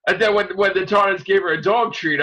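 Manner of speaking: usual speaking voice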